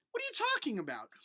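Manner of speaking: normal talking voice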